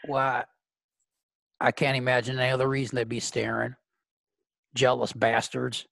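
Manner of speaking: lightly voiced cocksure date, slightly low; a touch of low, puzzled self-assurance; Clint, oblivious.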